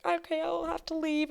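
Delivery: high-pitched, whiny mock crying; imitated sobbing. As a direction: squeak it out like someone tearful, hammed up. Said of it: "sobbing. She is so hurt".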